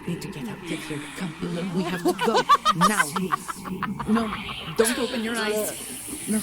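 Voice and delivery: loud, high, frantic urging; urgent panic. Acted. Friend panicking to wake Sleepless and escape, loud. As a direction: yell at them to flee.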